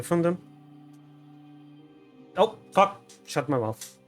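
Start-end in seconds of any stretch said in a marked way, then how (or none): none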